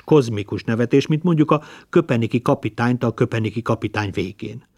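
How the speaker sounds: usual speaking voice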